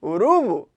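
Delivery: URUBU as u-RU-bu, stress on the middle; paroxytone stress. Shown as a demonstration. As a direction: move the stress of "urubu" onto RU. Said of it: "paroxítona". ("urubu" com a tônica no RU, do meio)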